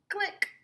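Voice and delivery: click. Pretend. Camera click.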